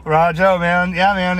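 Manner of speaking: normal talking voice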